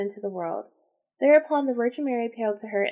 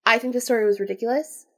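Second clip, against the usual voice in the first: usual speaking voice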